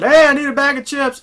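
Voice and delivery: high, loud, breezy interruption; casual intruder. Retold or imitated. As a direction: barge in asking for chips. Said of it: "somebody comes in and they said".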